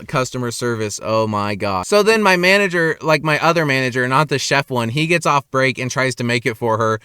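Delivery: ordinary talk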